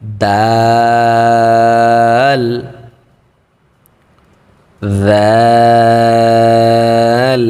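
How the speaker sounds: দাল chanted long and soft; long soft Dal. Shown as a demonstration. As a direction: hold দাল for three to four alifs. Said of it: "নরম করে পড়ব". (নরম করে লম্বা দাল)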